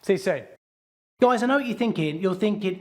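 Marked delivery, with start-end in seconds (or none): none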